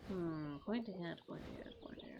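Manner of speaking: trailing off into a whisper; fading mutter. Real, not acted. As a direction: mull it over, dying away. Musing to himself, fading off.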